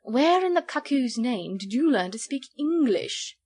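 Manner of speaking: slow, high, swooping disbelief; undisguised wonderment. A narrator's reading. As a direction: drawl it like an astonished fop. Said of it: "with undisguised wonderment".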